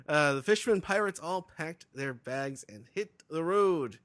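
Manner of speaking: normal talking voice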